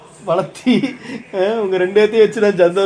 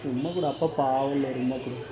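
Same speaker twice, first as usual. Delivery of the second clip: soft coaxing; 7 semitones lower, 12 dB quieter than usual. Acted. Father begging daughter for kiss.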